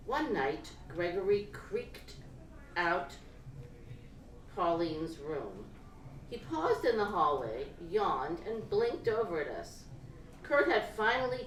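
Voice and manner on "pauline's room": usual speaking voice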